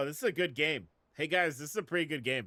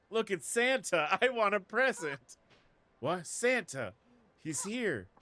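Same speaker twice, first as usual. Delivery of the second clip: put-on high loud kid voice; excited child; 7 semitones higher, 5 dB louder, slower than usual. Streamer voicing kids spotting Santa, high and eager. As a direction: cry out like a delighted kid.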